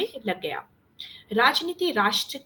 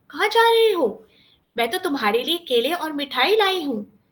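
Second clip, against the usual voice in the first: higher-pitched, coaxing aunt; fondly inquiring aunt; 5 semitones higher, 3 dB louder than usual. A narrator's reading. Aunt asking nephew where he is going, coaxing and high. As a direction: coax the child like a visiting aunt.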